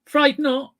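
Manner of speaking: high cartoon string delivering the punchline; squeaky string punchline. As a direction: deliver the frayed knot pun proudly.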